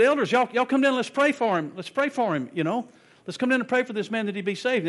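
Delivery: loud, high call to the elders. Retold or imitated. Preacher calling elders down to pray for the man, loud. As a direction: summon the elders from the pulpit. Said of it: "he called".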